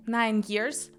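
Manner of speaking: usual speaking voice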